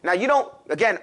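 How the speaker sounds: ordinary talk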